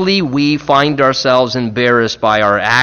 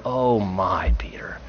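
heartbroken whisper; 5 semitones lower, 8 dB quieter than usual. Retold or imitated. Jesus' look imagined.

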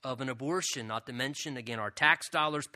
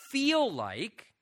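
"feel like" raised and stretched out slowly; stress on "feel like"; 6 semitones higher, 4 dB louder than usual. Real, not acted. Preacher on waiting for God's timing, stressing "feel like". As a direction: draw out "feel like" for emphasis. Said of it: "I stress the word feel like".

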